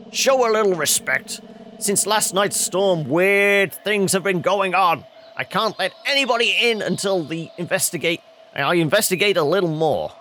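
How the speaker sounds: lightly voiced watchman, grave and emphatic; stern guard. Guard warning kids about weird things since the storm, stern.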